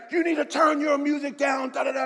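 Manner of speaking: loud, high mock yell; imitating a yell. Tall yoga instructor yelling at the pastor about the music, loud mock yell. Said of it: "and he's yelling".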